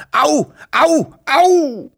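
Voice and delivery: high, loud cries of pain; pained scream. A narrator's reading. Wawuschel father caught in a fox trap, screaming in pain. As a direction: yelp "ow" in sudden agony. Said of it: "Gleichzeitig schrie er".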